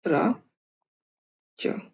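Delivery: ordinary delivery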